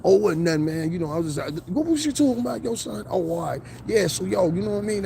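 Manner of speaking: switching back to slang. Retold or imitated.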